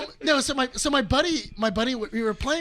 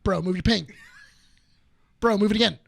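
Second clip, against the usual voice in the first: lower, held-back repeated request; strained niceness; 4 semitones lower, 4 dB louder, faster than usual. Retold or imitated. [name] repeating himself to Brian, restrained politeness. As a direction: ask nicely with patience running out. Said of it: "told him five times nicely".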